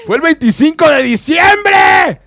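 slow, high indignant shout; outraged shout. Real, not acted. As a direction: cry it out in disbelief. Outraged retelling of breakup.

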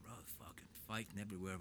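ordinary delivery

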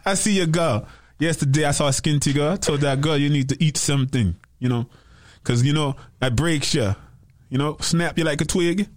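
put-on light Jamaican accent, laid-back; easy Jamaican lilt, playful. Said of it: "do your best Jamaican voice then".

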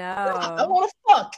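very high, loud eager squeal. Retold or imitated. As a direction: blurt it out, eager and giddy. Voicing Brad's eager desire.

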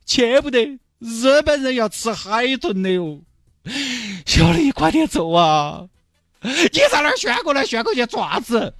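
high, hammed-up sentimental goodbye to the dolphin; tearful farewell. Mr Chen bidding the released dolphin farewell, hammed-up sentimental. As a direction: send the dolphin off like your own child, overplayed.

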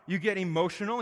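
normal talking voice

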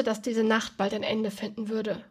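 usual speaking voice

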